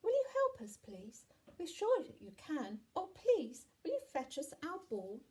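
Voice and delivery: put-on high, loud child voice; high-pitched, eager plea; pleading children. A narrator's reading. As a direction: beg like a little child. Children ask giant for help.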